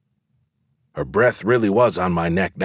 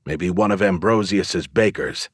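usual speaking voice

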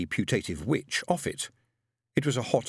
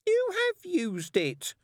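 put-on very high, drawn-out child's retort; squeaky little-girl indignation; snapped contradiction; much slower than usual. A narrator's reading. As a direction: contradict your big sister indignantly. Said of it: "snapped her sister".

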